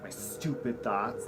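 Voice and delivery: ordinary delivery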